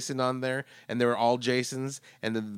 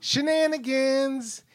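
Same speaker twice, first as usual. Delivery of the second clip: high, slow drawn-out word; sing-song drawl; 11 semitones higher, much slower than usual. Acted. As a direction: stretch it out playfully.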